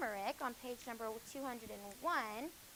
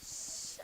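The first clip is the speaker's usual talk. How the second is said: hush; 4 dB quieter than usual. Real, not acted. Hushing chatty students.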